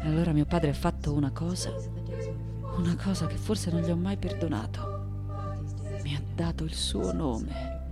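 soft, wounded recollection; hushed confession. Acted. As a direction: let the voice sink, nearly to yourself. Confides an unforgiven childhood wound. (confessione sommessa)

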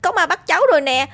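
very high panicked cries, fast in places; boy shrieking to grandpa that a ghost has him; terrified screaming for help. A narrator's reading. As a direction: scream as a hand grabs your ankle. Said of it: "Tôi hét lên".

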